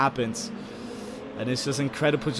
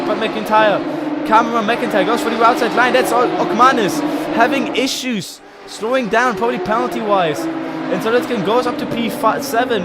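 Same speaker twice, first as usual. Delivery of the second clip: raised, quick live race commentary; motorsport commentator; 8 semitones higher, 6 dB louder than usual. Real, not acted. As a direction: call the race as it happens.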